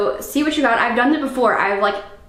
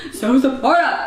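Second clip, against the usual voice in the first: sarcastic; 2 semitones higher than usual. Retold or imitated. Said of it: "And I was like".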